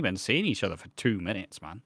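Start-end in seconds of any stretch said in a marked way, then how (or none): none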